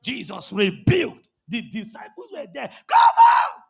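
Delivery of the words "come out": explosive high, loud shout of authority; authoritative command. Shown as a demonstration. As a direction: cast it out with full-voiced authority. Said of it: "It's not shout, it's authority".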